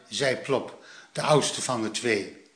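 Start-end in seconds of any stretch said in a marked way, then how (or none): none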